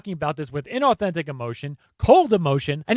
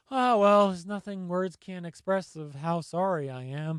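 offhand fake apology, sinking softer; mock indifference; 2 semitones lower, 6 dB quieter than usual. Commentator mimicking killer's cold apology, mock indifference. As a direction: imitate someone apologizing without caring, trailing down quietly. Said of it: "he's talking about it like".

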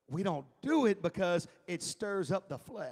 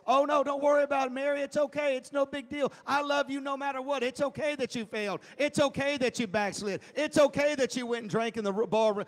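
higher, quick, overly sweet voicing of an indulgent Jesus; mock doting reassurance; 7 semitones higher, 6 dB louder than usual. Preacher mocking a Jesus who excuses sin, gushing sweetly. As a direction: gush it like a pushover who excuses everything. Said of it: "he never said".

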